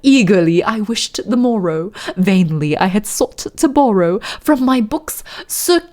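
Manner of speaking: slow, measured mock-British poetry reading; fake British accent. Streamer reading The Raven to chat, slow fake British accent. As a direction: recite gravely in a put-on British accent, lingering on words. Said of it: "You guys really want the fake British accent?".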